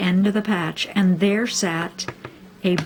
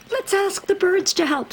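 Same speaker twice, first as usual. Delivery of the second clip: much higher squeaky hare voice; tiny eager hare; 13 semitones higher, faster than usual. A narrator's reading. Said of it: "said Hare".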